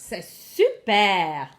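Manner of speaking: hammed-up slow French enthusiasm; overacted. Shown as a demonstration. Teacher showing pupils how to praise, overacted. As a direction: overact it, drawing the words right out. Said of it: "Let's overact this".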